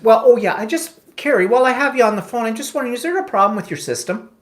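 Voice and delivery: high-pitched mock-innocent sarcasm; sarcastic. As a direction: ask it with exaggerated, sarcastic politeness. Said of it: "I was being sarcastic in my voice".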